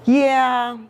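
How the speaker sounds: ordinary delivery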